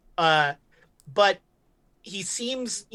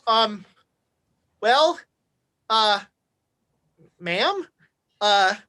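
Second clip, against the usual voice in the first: nervous; 3 semitones higher, 4 dB louder, much slower than usual. Acted. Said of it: "he is super nervous right now".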